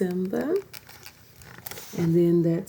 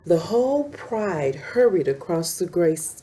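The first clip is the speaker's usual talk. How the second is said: plain reading voice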